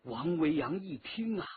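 ordinary delivery, nothing marked

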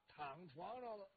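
plain reading voice

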